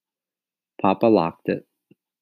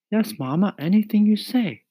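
put-on high boyish compliance; agreeable boy Mario. A narrator's reading. Mario promises Mama anything, high and eager. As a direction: agree readily at a high pitch.

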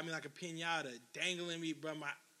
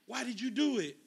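higher-pitched retold dad's demand; angry interrogation; 5 semitones higher, 4 dB louder than usual. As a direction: land the hit, then demand an answer.

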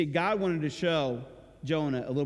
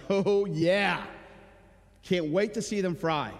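mock gleeful Jonah; 4 semitones higher, slower than usual. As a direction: gloat like a spiteful prophet. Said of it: "being like".

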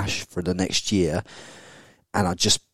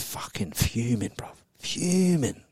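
whispered emphasis; 3 semitones higher, 8 dB quieter, slower than usual. Real, not acted. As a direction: hiss the word for effect. Stresses how angry she was.

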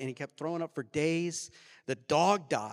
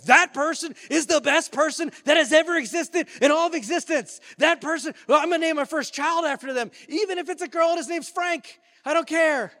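high, loud, over-the-top gushing; gushing excitement; 12 semitones higher, 11 dB louder than usual. Acted. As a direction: rave at full volume about an amazing benefactor. Imagined gushing reaction to gift.

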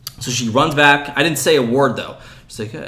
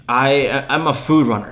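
ordinary talk

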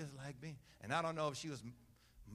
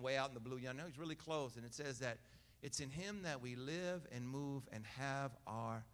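ordinary delivery

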